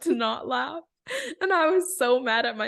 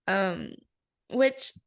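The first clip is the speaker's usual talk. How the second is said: normal talking voice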